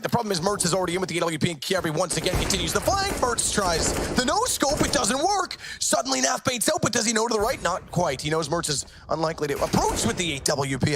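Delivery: rising, fast hype call; excited play-by-play. Real, not acted. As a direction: build the hype as the clutch unfolds. Clutch round hype call.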